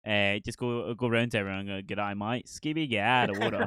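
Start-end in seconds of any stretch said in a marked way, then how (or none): 1.9-3.7 hammed-up Australian impression, breaking into laughter; Australian accent. Shown as a demonstration. Showing off a childhood Australian accent to a friend, jokey Aussie impression. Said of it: "I could do an Australian accent perfect".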